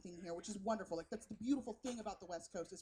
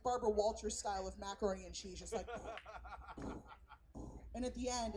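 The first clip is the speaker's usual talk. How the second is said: ordinary delivery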